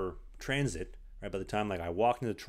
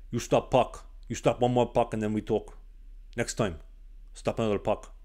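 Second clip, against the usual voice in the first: put-on louder, curt Eastern European accent with broken English; a stern coach in a thick Slovak accent, putting the player off; clipped Eastern European accent, firm and final; 4 dB louder than usual. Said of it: "had a very thick Eastern European accent".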